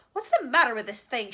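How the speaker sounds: loud frustrated shout. A narrator's reading. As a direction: shout at the broken phone. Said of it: "shouted the president".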